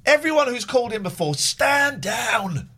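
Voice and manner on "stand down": ordinary talk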